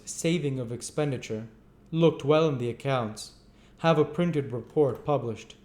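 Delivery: quoted board phrases. Acted. Board members' repeated phrases.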